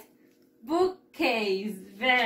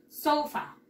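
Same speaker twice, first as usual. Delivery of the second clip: "sofa" with a high first syllable, then falling; stress on O; 4 semitones higher than usual. Shown as a demonstration. Teacher modelling English "sofa", stress on the O. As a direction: hit the "so" of "sofa", pronounced like sou. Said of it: "la mayor fuerza de voz le vamos a dar aquí en la O".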